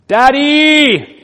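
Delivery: put-on loud, high child's cry of Daddy. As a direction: shout it like a scared child at night.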